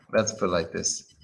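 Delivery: ordinary talk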